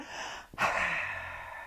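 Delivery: long hissing angry exhale; voiceless snake hiss of anger; angry breath. Shown as a demonstration. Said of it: "angry breaths".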